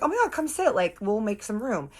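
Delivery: high-pitched, gushing insistence; eager invitation. Retold or imitated. Birthday girl invites Harley to sit, eager and high. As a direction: imitate an excited hostess, pitch up. Danielle urges Harley to join.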